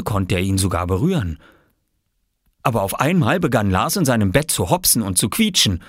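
ordinary delivery, nothing marked

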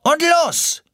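very high, loud launch shout; bellowing. Acted. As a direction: yell her off down the slide. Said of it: "brüllte Timo". (sehr hoch und laut gebrüllt)

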